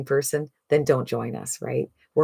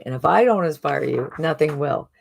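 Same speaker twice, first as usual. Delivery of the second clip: ordinary delivery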